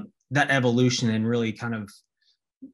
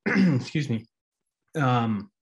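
hoarse, cracking throat; losing voice mid-sentence; voice giving out; slower than usual. Real, not acted. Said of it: "You, you losing your voice there".

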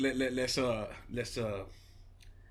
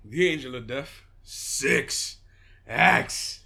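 wrestler entrance; 4 semitones lower, 7 dB louder, slower than usual. Pretend.

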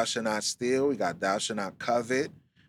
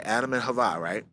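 usual speaking voice

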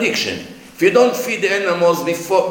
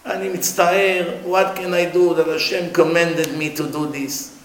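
usual speaking voice

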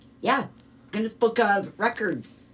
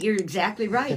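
normal talking voice

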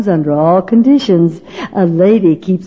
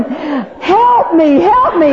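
screaming for help; 7 semitones higher, much slower than usual. Retold or imitated. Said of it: "and I was screaming".

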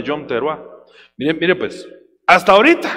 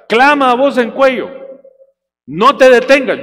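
proclaiming loudly; 7 semitones higher, 7 dB louder than usual. Shown as a demonstration. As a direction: call it out like a trumpet. (proclamación lenta y fuerte)

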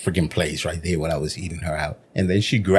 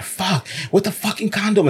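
freaking out; 12 semitones higher than usual. Retold or imitated. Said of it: "she's all freaking out".

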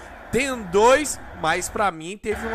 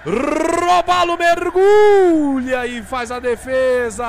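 excited save call; 4 semitones higher, 6 dB louder than usual. Real, not acted. Penalty save called.

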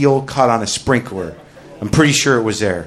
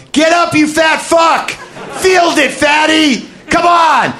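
high, slow mock coach's yell, much louder; 3 dB louder, slower than usual. Coach screaming insults at the fat kid, loud mock yell. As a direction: bellow it like an abusive coach. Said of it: "the coach is yelling".

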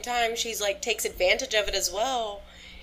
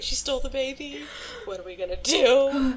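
higher, laughing mock alarm; mock panic; 5 semitones higher than usual. Recalling her reaction to the twist, half-laughing panic. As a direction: replay your own panicked reaction. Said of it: "I was like".

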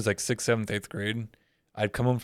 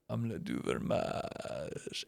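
slow, soft, breathy French line; suave whisper; 3 semitones lower, 14 dB quieter, much slower than usual. Retold or imitated. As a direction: purr it to charm the girls.

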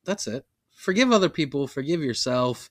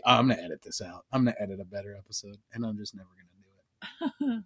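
lower, softer mock sign-off, rushing; 5 semitones lower, 6 dB quieter, faster than usual. Host jokes about his new sign off, lower and mumbled. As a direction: toss off a fake promise. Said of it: "my new sign off will be".

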